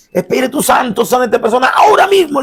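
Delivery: high-pitched shouted demand, peaking at the end; mock shouted command. Believer ordering the Holy Spirit to heal, shrill mock shouting. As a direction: imitate a believer yelling orders upward. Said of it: "¿Por qué usted me grita?".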